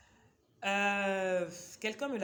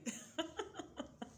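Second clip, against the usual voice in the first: soft high breathy giggle; short amused giggle; 11 semitones higher, 5 dB quieter than usual. Real, not acted. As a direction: laugh at your own silly dream. Laughs at her own book-bed idea.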